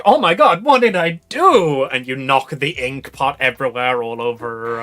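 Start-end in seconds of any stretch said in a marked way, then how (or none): 0.0-1.8 panicked gasp. Acted. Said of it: "as you're like".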